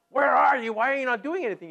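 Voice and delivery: loud, high accusing cry; high, loud frustrated protest; demanding cry. Retold or imitated. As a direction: demand answers from God. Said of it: "instead of".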